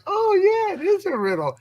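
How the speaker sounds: high, delighted oh of discovery; mock sudden realization. Imitating people realizing it's a riddle, high surprised. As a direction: play everyone suddenly getting it.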